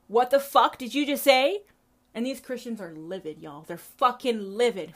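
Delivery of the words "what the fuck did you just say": louder, higher outraged demand; outraged repeat. Real, not acted. Reacting to the pastor's clip, louder outraged demand. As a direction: throw the question back, incensed.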